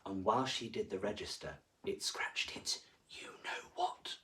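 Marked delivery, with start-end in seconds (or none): 1.8-4.2 cheeky whispered aside; soft, high whispered aside. A narrator's reading. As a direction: whisper it like a naughty secret. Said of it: "whispered aside".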